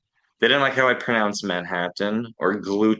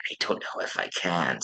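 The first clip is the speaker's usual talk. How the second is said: low, soft, self-conscious mumble; meekly retold, quiet and low; retold helpless reply; 4 semitones lower, 6 dB quieter than usual. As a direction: imitate a helpless protest, voice dropped low. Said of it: "and I'm like".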